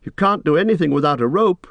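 high-pitched, firm calling; Frodo calling back strongly. A narrator's reading. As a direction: call up to hold someone back. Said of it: "Frodo called back more strongly now".

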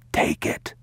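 fully voiceless urgent whisper. A narrator's reading. Father insists the boy takes the gun, whispering. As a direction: breathe it out, no voice at all. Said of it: "he whispered".

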